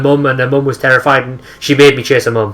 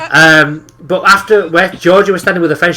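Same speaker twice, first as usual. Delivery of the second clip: usual speaking voice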